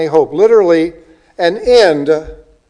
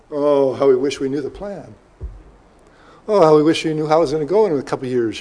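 mock wistful wishing; 2 semitones lower than usual. Voices people wishing to know.

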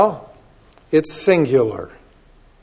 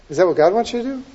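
ordinary delivery

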